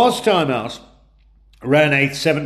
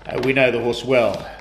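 ordinary talk, nothing marked

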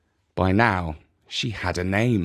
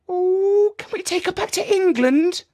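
put-on very high, begging child; a girl begging to keep the cat; pleading little girl. A narrator's reading. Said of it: "pleaded Jemima".

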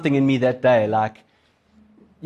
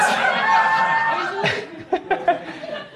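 high burst of laughter. Real, not acted. Preacher laughing at his own story, high squealing laugh. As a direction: crack up at the joke.

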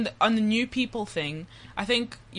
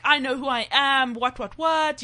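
higher mocking voice of the too-cool student; 6 semitones higher than usual. Student mimicking cool first-years fresh from high school, mock cool. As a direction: put on a self-assured act, pitched up. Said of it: "everyone thinks they're so cool".

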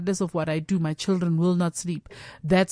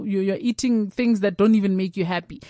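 ordinary delivery

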